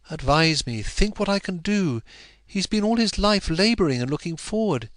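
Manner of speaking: a touch of passionate appeal; Dorothea pleading. A narrator's reading. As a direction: beg for advice. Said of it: "her passionate cry".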